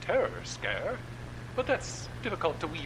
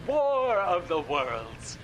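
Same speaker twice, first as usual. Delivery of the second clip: slow, grand and a bit louder, like a radio announcer; dramatic slow announcement; 5 semitones higher, 6 dB louder, much slower than usual. Acted. Radio host grandly announces the famous broadcast title, slow.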